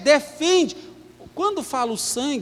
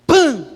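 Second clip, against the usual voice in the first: mock bang; 4 semitones higher, 7 dB louder than usual. Sound effect for the skull sign.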